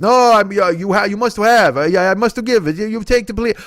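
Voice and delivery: put-on high, eager accented old deli man; high eager voice of the old deli owner pushing a huge sandwich. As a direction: gush like a proud old shopkeeper. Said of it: "owner's reply in quarrel".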